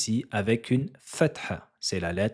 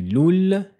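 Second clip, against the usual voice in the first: loul pitched high and loud; teacher hammering the doubled letter loudly; doubled lam; 9 semitones higher, 6 dB louder than usual. Shown as a demonstration. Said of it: "et elle est dédoublée".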